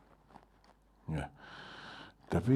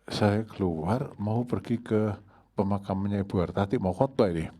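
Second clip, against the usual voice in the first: normal talking voice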